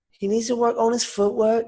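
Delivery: high, hammed-up Liverpool Scouse accent; a rough imitation of a Liverpool Scouse fighter; bad Scouse accent. Darren Till giving advice to Mike Perry, in a mock Scouse accent. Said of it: "a shit accent, but, like, he's a Scouse".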